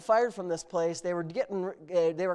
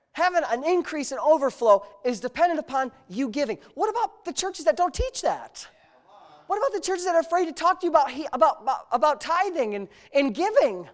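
high, loud sermon build; preacher rousing the congregation; full-force preaching; 6 semitones higher, 7 dB louder than usual. Acted. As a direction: preach it up to the crowd.

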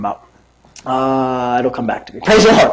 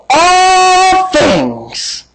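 long high drawn-out shout of all things; preaching; 11 semitones higher than usual. Acted.

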